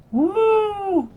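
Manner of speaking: high, loud party whoop; rowdy party animal. Retold or imitated. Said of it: "I did a terrible version of it".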